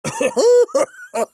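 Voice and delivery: put-on sudden high-pitched old man's cackle. Old sage chuckling at Nanaki, high cackle.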